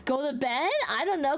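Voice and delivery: ordinary delivery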